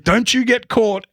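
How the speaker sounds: hammed-up boss wagging a finger; mock foreman warning. Foreman catching him in the tank, mock warning voice. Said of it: "he'd be like".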